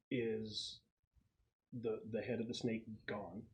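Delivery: ordinary talk, nothing marked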